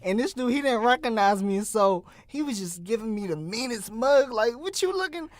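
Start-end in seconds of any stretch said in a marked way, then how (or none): 4.6-5.3 mock tough guy. Retold stare-down on sidewalk.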